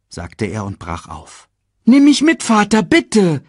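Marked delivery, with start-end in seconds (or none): 1.9-3.4 put-on high, loud pleading child; begging. A narrator's reading. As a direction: beg your father eagerly. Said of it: "bettelte Simba".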